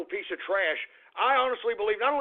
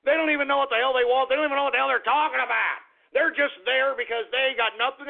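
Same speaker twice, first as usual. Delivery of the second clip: fast, higher, heated outburst; exasperated rant; 4 semitones higher, faster than usual. Real, not acted. Host sick of useless protesters, fast exasperated rant. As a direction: rattle it off fast in mounting anger.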